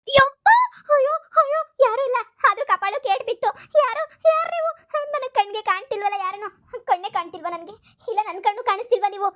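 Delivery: high, loud, shrill-pitched cries after a slap; hammed-up panicked shrieks. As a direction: shriek in comic panic, looking for the slapper. Grandma slapped by unseen hand.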